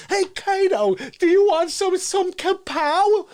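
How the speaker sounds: high, slow, chatty voice to an imaginary friend; Fauci talking to nobody. Retold or imitated. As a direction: offer a drink to the wall. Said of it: "He's still".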